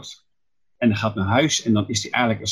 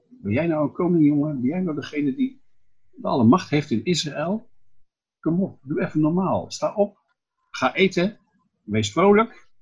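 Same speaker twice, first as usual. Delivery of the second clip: higher and louder, scornful rallying of a weak king; mocking taunt; 7 semitones higher, 4 dB louder than usual. Izebel scolds the sulking king Agab, higher, loud mocking. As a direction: scoff at him, then push him up.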